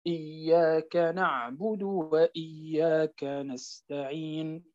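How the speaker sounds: slow recitation of إياك نعبد وإياك نستعين, pausing on نستعين; Quran recitation. Shown as a demonstration. Teacher demonstrating the pause on نستعين, slow recitation. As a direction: recite slowly and stop on نستعين. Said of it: "أيضًا نستعين".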